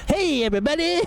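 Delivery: very high goofy greeting into silly cackle; candy cane face character. Pretend. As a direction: squeal it as a cartoonish new persona. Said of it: "candy cane face".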